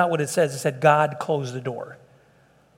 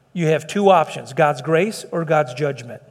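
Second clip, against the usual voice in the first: ordinary talk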